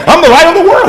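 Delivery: very high, loud proclamation; crying out. Acted. Preacher repeats Jesus' claim to the multitude, crying out. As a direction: cry it out to the whole crowd. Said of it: "He cried out and said it".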